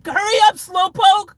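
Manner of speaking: high, loud cheeky jeer; mock honking taunt. Honking at slow drivers.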